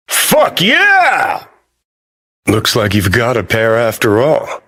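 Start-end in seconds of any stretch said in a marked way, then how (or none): 0.1-1.5 high, drawn-out whooping yell; exultant shout. Acted. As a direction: let it rip in a triumphant holler. Exultant cheer during game action.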